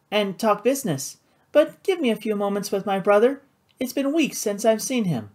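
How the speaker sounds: high, airy feminine voice; sociable sister. A narrator's reading. Flora asking time with her brother, lightly polite. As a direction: politely excuse yourself, pitched high.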